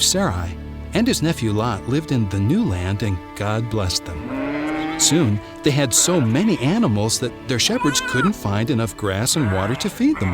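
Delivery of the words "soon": ordinary delivery, nothing marked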